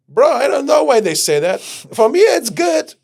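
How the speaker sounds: a high, loud bouncer voice; breezy bouncer. Retold or imitated. The bouncer telling him the shoes are fine, high and loud.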